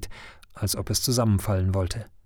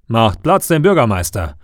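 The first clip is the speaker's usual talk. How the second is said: loud call clearing the way; 7 dB louder than usual. Acted. Mayor pushing through the crowd, calling out loudly. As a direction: shout it from afar over the crowd. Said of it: "ruft er schon von weitem".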